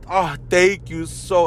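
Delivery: high, slow, drawn-out fake thanks; milk the gratitude sarcastically; hammed-up overwhelmed thanks; mock gushing. Mock gushing reply to hater.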